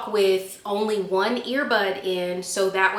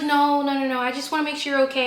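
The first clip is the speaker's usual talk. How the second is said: higher, hurried mock concern; insistent scooter rider; 5 semitones higher, faster than usual. Scooter rider following the woman she hit, hurried insistence. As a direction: imitate someone fussing anxiously. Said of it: "And she's like".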